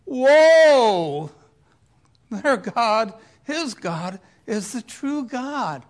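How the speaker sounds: loud, high drawn-out whoa, then proclaiming; amazed crowd. Acted. Onlookers see God's fire fall, amazed. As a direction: exclaim like an awestruck crowd.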